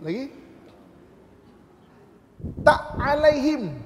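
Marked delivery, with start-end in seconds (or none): 2.6-3.8 Tak alaihim with an added ain sound before alaihim, slow and loud; wrong extra ain. Shown as a demonstration. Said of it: "tambah satu huruf ain".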